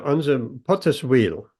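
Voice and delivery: usual speaking voice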